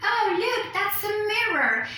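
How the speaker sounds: bright sing-song discovery of a mirror; excited exclamation. Shown as a demonstration. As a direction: point it out delightedly, sing-song.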